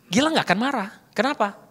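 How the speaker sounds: normal talking voice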